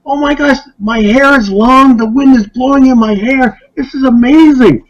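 high, awed and delighted; excited. Retold or imitated. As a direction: lose yourself in the wonder of it. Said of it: "He's getting more excited".

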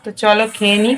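ordinary talk, nothing marked

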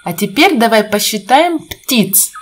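usual speaking voice

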